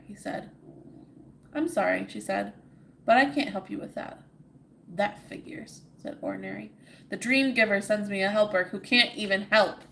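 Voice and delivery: steady storybook reading; story narration. A narrator's reading. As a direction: read it like a bedtime tale. Reads the story aloud.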